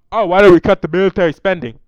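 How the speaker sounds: shouted impression of a politician; Bernie Sanders accent. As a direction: blare it out in a put-on accent. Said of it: "Let me try to do my best accent".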